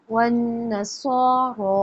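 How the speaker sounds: वन्नसारा with a heavy स्वाद and a two-beat vowel; slow model reading of वन्नसारा. Shown as a demonstration. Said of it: "उस पर दो हरकत".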